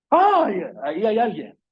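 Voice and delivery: sudden high gasp, then a hushed ahí hay alguien; startled cry. Pretend. Preacher acting a listener startled by someone behind: high startled cry. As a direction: jump at a presence behind you.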